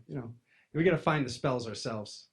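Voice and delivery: ordinary delivery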